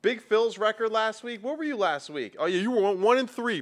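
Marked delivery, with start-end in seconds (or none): 0.0-1.8 gloating. Real, not acted. Son rubbing his winning record in his dad's face.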